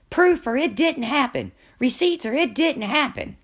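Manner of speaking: high, mocking sing-song of a demanding woman; mocking Diane. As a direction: pitch it way up and mock her demand. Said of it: "We would have Diane saying".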